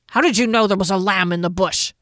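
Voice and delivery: gruff growl of a question; growling dwarf. A narrator's reading. Dwarf challenging the halfling about the lamb, growling. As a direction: growl it out suspiciously. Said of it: "Janek growled".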